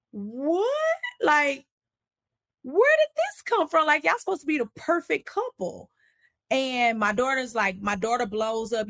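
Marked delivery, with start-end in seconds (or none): 0.1-5.9 much higher, incredulous voice; shocked family. Retold or imitated. Family reacting to him throwing the money, stunned and high. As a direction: gasp out the disbelief of the whole family. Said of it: "My family is looking like".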